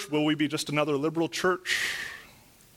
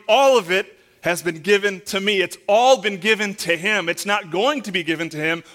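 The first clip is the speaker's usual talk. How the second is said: loud, raised preaching voice, hammering the key words; emphatic preaching; 3 semitones higher, 6 dB louder than usual. Real, not acted. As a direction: drive it home to the congregation with force. Preaches at full force.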